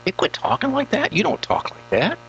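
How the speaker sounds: a hint of a scolding wife; imitating wife. Wife Nina scolding husband for his silly voices, lightly mimicked. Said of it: "She'll say".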